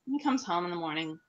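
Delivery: ordinary delivery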